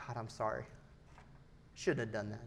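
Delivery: soft, contrite prayer; hushed, regretful admission; penitent apology. Acted. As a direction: confess quietly to God. Acts out prayer of repentance.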